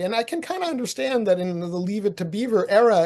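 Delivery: ordinary talk, nothing marked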